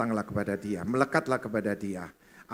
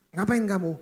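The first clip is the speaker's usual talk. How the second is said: higher, sharp startled husband asking; 10 semitones higher, 4 dB louder than usual. Retold or imitated. Husband waking up from snoring to his wife. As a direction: snap awake and demand what she's doing.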